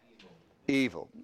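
ordinary talk

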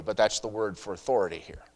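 normal talking voice

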